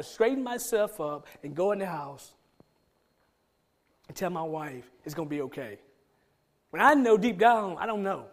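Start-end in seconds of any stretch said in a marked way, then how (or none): none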